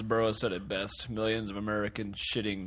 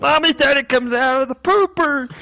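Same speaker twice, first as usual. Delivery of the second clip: put-on much higher, loud kid voice; childish voice; 12 dB louder than usual. Joking about poop with friends, a loud high kid voice. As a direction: play a little kid tattling.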